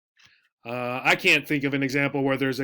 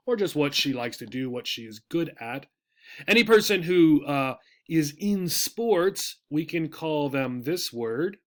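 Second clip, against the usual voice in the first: usual speaking voice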